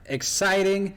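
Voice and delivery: high, slow showman welcome; announcer voice. Acted. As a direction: belt out the grand welcome slowly. Podcast host opening.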